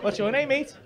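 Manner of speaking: normal talking voice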